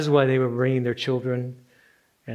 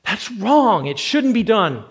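higher, louder protest; voiced indignation; 8 semitones higher, 5 dB louder than usual. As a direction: let the outrage rise in protest. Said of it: "You feel this indignation".

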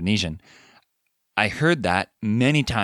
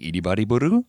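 imitated foreign question, BURU rising at the end; questioning tune; faster than usual. Podcast host asking the guest in Indonesian, imitated questioning tune. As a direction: mimic "Iri bari buru" as a question climbing on "buru". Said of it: "something that sounds like a question".

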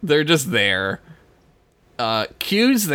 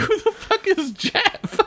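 laughing. Real, not acted. Laughing retort.

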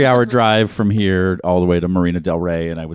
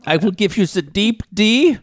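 slow, higher hammed-up German accent; 6 semitones higher, slower than usual. Joking after the German accent request, mock German accent.

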